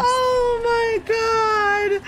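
high, slow, stretched-out delight; thrilled cry. Real, not acted. Streamer thrilled by a gifted sub, drawn-out happy cry. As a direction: squeal it out with joy.

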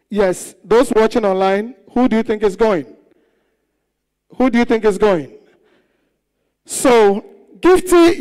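loud, booming stage announcer; show host announcing. Acted. Host announcing the eviction to the audience, booming. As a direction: announce to the whole hall.

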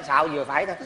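ordinary delivery

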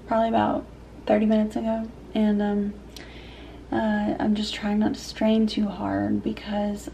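usual speaking voice

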